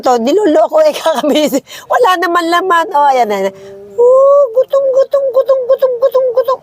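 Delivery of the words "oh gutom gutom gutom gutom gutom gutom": high repeated cooing. Real, not acted. Baby talk to hungry kids.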